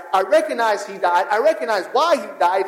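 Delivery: normal talking voice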